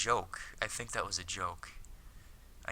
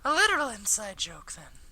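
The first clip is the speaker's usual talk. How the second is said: teasing anime schoolgirl; 13 semitones higher, 4 dB louder than usual. Acted. Akiko answers Hikaru's inside joke, high schoolgirl voice. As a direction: play Akiko high, gently dry.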